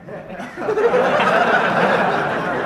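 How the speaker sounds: a high, airy real laugh; quick high burst of laughter. Real, not acted. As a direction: laugh it off, amused. Reacting to cocky invite.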